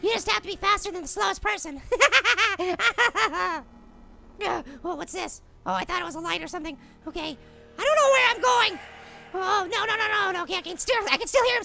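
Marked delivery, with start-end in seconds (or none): 1.9-3.6 long, rapid chuckle; mischievous laugh. Real, not acted. Player joking about outrunning the slowest person, laughing. Laughs at own grim remark. 4.4-7.4 hushed, low nervous voice; wary. Real, not acted. Player alone checking a light in the dark, wary.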